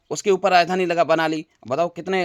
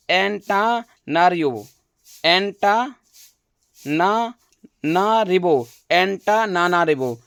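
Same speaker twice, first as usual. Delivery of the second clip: very slow, one syllable at a time; slow syllable drill; much slower than usual. Shown as a demonstration. Teacher helping students memorise a capital, very slow syllables. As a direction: drag out एंटानानारिबो syllable by syllable so students catch it. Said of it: "ध्यान रखना".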